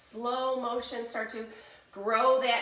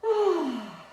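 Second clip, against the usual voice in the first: long audible exhale gliding down; demonstrated calming out-breath; relaxing sigh; 6 semitones higher than usual. Shown as a demonstration. Models a resting breath.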